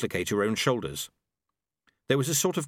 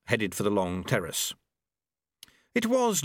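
plain reading voice

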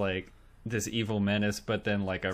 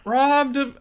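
high, loud, badly faked criminal swagger; putting it on; 13 semitones higher, 10 dB louder than usual. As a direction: overact trying to pass as a crook. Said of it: "he's putting it on a little bit".

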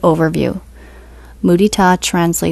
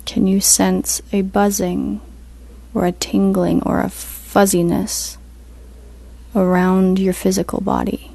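guided meditation; 4 dB quieter than usual. Acted. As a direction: ease them into stillness, unhurried.

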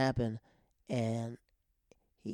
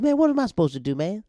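high, loud protesting whine; put-on defensive outburst, pitch jumping up; mock exasperation; 7 semitones higher, 7 dB louder, faster than usual. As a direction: imitate a friend pleading helplessly. Acting exasperated friend defending himself.

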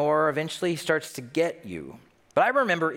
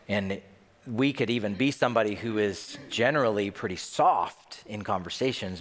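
normal talking voice

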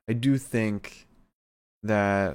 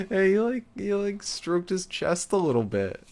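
laughing; 9 semitones higher than usual. Real, not acted. Laughing while retelling the moment.

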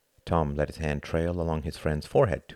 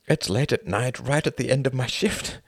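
quoted woman; 6 semitones higher than usual. Acted. Hart quotes the woman's reply.

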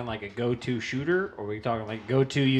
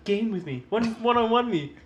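high, eager, loud gamer bro; thirsty gamer voice; 10 semitones higher, 4 dB louder than usual. Pretend. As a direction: beg for a match like a try-hard. Mocking thirsty male gamer.

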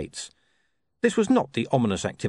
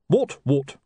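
higher, louder retort; Colon's retort; 5 semitones higher, 4 dB louder than usual. Acted. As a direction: push back with the question.

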